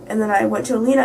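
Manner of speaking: normal talking voice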